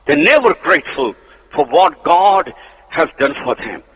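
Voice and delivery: ordinary delivery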